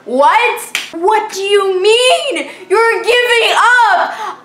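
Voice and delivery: high, loud, slow half-crying wail; disbelieving friend giving up, cry-laughing; mock distress. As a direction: wail it like a betrayed teen. Reacting to friend quitting.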